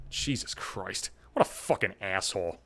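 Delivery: disgusted aside; muttered disgust. Real, not acted. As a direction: say it under your breath.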